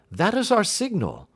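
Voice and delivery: spring up at the signal; excited. Acted. Detective alerting his friend the signal has come, excited and loud.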